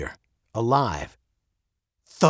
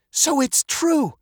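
put-on slow, high girlish realization; young girl; slower than usual. A narrator's reading. Veronica catches her father dodging, slow and childlike. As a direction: conclude it slowly, as a child catching on. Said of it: "she said".

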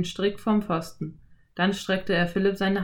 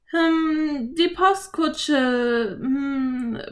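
put-on high, slow girl hesitating; stalling for an excuse; hesitant Anne; 7 semitones higher, much slower than usual. A narrator's reading. (zögerndes Mädchen, hohe Stimme)

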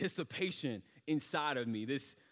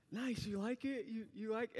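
hopeful mom; 7 semitones higher, 7 dB quieter than usual. Retold or imitated. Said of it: "she's like".

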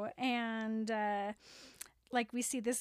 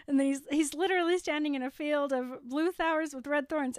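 ordinary talk, nothing marked

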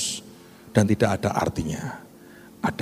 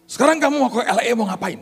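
brisk officer question; 11 semitones higher, faster than usual. Retold or imitated. Pastor voices the visa officer.

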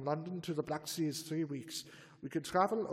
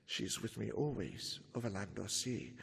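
quoting Dracula; 8 semitones lower, 7 dB quieter than usual. Retold or imitated. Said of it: "he said".

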